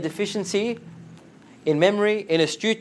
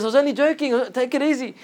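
pleading excuse; 8 semitones higher than usual. Retold or imitated. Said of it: "He goes".

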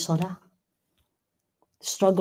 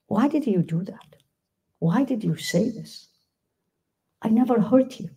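mild, imitated hurt; hurt reproach; 4 semitones higher than usual. Voicing a hurt loved one.

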